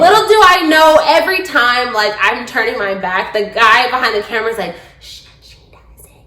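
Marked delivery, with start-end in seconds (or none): 5.0-6.2 hushed unvoiced whisper. Cameraman secretly hushing the others, whispering. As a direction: hush them so she can't hear.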